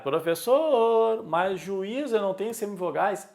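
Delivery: a hint of a student pushing back; arguing student. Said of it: "alguns vão brigar".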